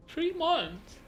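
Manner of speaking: high-pitched, incredulous question; surprised question. Real, not acted. Streamer reacting to how long the mentoring lasted, surprised.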